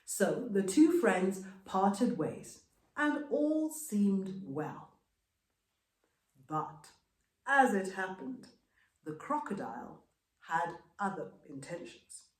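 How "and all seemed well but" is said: ordinary delivery, nothing marked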